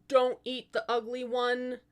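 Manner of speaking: ordinary delivery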